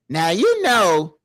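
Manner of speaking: put-on slow, drawn-out Southern Belle accent, lightly done. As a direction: imitate his friend's Southern Belle drawl, slowly. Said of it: "that Southern Belle accent".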